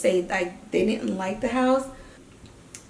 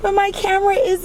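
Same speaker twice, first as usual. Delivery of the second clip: much higher and louder, gushing with delight; overjoyed; 13 semitones higher, 10 dB louder than usual. Real, not acted. Vlogger gushing to her friend about her camera, thrilled high squeal.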